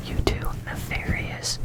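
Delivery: ordinary talk, nothing marked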